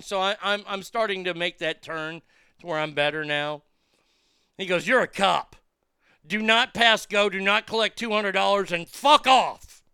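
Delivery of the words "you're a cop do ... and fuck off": loud, higher, slowing hard on the last words; angry yelling. Retold or imitated. Patient telling the narrator off, loud and slowing into a yell. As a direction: build to a shouted kiss-off. Patient angrily repeats accusation.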